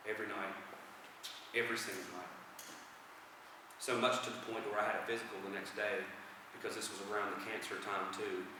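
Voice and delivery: low, soft and heavy testimony; hushed, low admission of a painful past; subdued confession. Real, not acted. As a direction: confess it quietly, voice dropped right down. Testimony about drinking after mother died.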